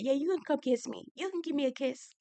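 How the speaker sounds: high, soft mock come-on; mockingly coy quote; mocking imitation. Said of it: "the way Alex said that".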